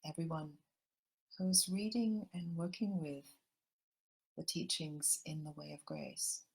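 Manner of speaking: gentle meditation guide drawing everyone into the heart; guided meditation. Acted. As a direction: ease the listeners into calm, unhurried.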